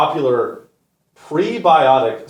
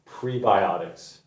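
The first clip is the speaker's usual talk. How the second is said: slow, softer, drawn-out scorn; disgusted; 6 semitones lower, 6 dB quieter, slower than usual. Real, not acted. Repeating the product word with slow disgust. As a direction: say it like a dirty word.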